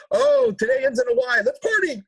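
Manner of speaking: loud, very high mock excitement; festive party cry. Colombians finding a reason to party, festive cry. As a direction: whoop it like any excuse will do.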